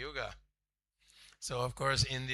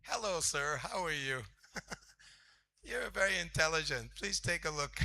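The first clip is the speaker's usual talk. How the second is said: mock salesman pitch; 4 semitones higher, 4 dB quieter than usual. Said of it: "sitting on the bed going".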